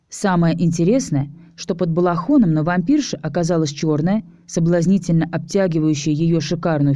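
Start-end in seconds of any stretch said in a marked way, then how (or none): none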